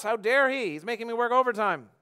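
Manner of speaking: loud, high-pitched outrage at the boss; indignant outburst. Acted. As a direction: protest like a wronged employee. Acts out resentment at boss.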